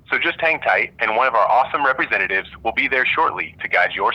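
phone menu voice. Real, not acted. Automated phone menu recording.

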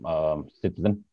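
ordinary talk, nothing marked

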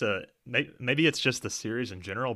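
ordinary delivery

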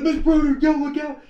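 very high, loud cry of alarm; warning scream. Retold or imitated. Sledder screaming at teacher in his path, panicked yell. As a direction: shriek a warning to get clear. Yelling warning from speeding sled.

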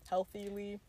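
"Healthily" said softly with a clear th; "Healthily" fixed to hel-thi-lee; corrected word. Shown as a demonstration.

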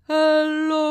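a loud, drawn-out creature hello; the sloth calls down his greeting, stretched long; slow sloth greeting. Acted. Sloth greets Sasha on the roof, slow and loud. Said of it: "he said".

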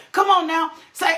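ordinary talk, nothing marked

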